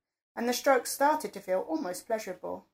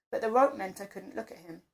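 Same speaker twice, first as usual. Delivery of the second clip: ordinary delivery, nothing marked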